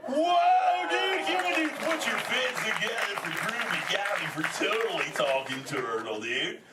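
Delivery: crowd-rousing surfer praise; stoked surfer cheer. Acted. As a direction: whoop it up as a turtle dude. Hailing a child's success to crowd.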